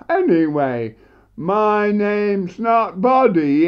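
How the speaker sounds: high-pitched, exasperated recital; indignant comic monologue. Acted. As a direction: build to a loud, protesting punchline.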